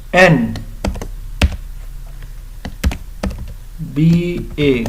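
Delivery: این بی اے spelled slowly, letter by letter, with long pauses; teacher spelling an abbreviation slowly; letter-by-letter demo. Shown as a demonstration. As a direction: spell out این بی اے slowly so the vowel start shows. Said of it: "این، ای این کی آواز دے رہا ہے".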